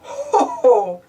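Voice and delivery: spoken daddy chuckle; jolly mock laugh. Daddy Pig amused by Peppa, jolly ho ho. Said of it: "laughs Daddy Pig".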